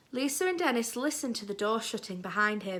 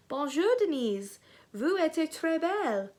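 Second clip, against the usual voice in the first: put-on higher-pitched playful French accent; 6 semitones higher than usual. A narrator's reading. Lisa greets Denise, playing along in a French accent. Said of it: "in a French accent".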